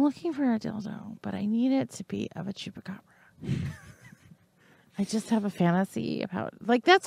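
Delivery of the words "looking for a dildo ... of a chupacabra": hushed secret request; whispering. Pretend. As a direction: whisper it fast like a confession.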